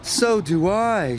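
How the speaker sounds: high, slow groan. A narrator's reading. Mavis also sick, groaning. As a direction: moan it queasily. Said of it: "she groaned".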